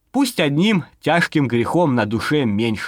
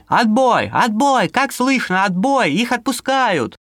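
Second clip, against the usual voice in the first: raised call crackling through radio; over walkie talkie; 8 semitones higher than usual. Acted. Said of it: "потом вопль".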